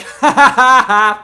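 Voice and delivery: high laughing outburst; cracking up at a viewer's name; loud burst of laughter. Real, not acted. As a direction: crack up loudly.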